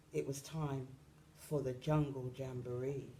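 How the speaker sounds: usual speaking voice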